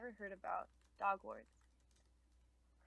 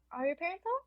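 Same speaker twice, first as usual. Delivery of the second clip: Harold asking; 10 semitones higher, 5 dB louder, faster than usual. Acted. GM voicing Harold at door.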